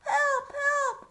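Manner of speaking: high loud cry for help. Acted. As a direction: shout it out like a panicked page. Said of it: "cried the page".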